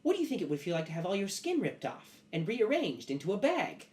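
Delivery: high, swooping metal creature; Alloy. Acted. Metal creature questioning its guest, eerily polite. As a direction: ask the horrid question in a high, stilted voice. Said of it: "And, and Alloy goes".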